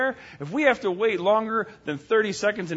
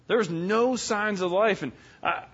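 ordinary talk, nothing marked

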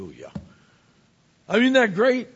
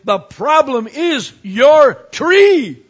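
slow, much louder, higher hammered point; emphatic punchline; 7 semitones higher, 11 dB louder, much slower than usual. Acted. Pastor tells the congregation their problem, slow hammered emphasis. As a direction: land each word slowly and hard.

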